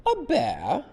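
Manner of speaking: loud, very high, incredulous question; high-voiced woman. Parent voices Missus Brown to children, high-voiced. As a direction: squeal it like a startled storybook lady. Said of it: "the woman with the high voice".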